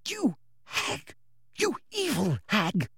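hushed, venomous whisper; whispering, tearful and furious; whispered fury. A narrator's reading. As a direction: hiss the insult under your breath. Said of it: "she whispered as Umbridge walked".

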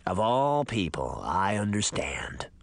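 low, slow crackle of an ancient man; dry, parched old voice; crackling voice. Acted. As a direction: let the words creak out low. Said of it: "the voice crackled".

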